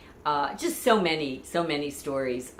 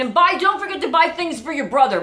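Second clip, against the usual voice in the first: dad calling out; 9 semitones higher, 7 dB louder than usual. Retold or imitated. Father's reminder quoted.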